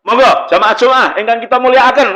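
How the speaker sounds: lebih tinggi dan keras, tegas; khotbah tegas. Shown as a demonstration. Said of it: "mesti beda". ("Monggo jamaah Jumat" diserukan tinggi dan tegas)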